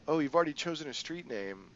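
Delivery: a mocking older crime boss; amused crime boss. GM voices Ching.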